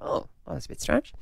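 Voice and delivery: whispered puzzled aside; hushed, breathy whisper. Retold or imitated. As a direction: whisper it as if to yourself. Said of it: "I'm like".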